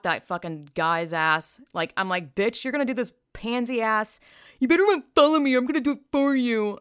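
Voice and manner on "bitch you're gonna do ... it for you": high, loud, sneering mock of his threat; hoity-toity mockery. Mocking the verified guy's threat, hoity-toity and loud. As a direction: lord it over her, puffed up and snooty. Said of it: "like, hoity-toity".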